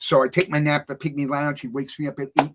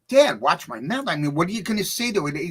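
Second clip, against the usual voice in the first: acted-out protest, climbing higher and higher; indignant child protest; 6 semitones higher than usual. Eight-year-old protesting to Dad about watching his mouth, indignant. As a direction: argue back like an incredulous kid, pitch rising.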